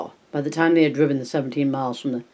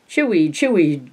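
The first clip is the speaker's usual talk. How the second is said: put-on toddler squeal; 4 semitones higher than usual. A narrator's reading. Gage copying his sister, a light toddler squeal. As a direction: chant it like a happy toddler. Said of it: "Gage screamed in Lewis's".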